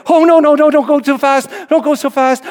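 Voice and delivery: high, loud mock panic; scared passenger. Boy pleading on a speeding four-wheeler, scared. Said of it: "he's really scared".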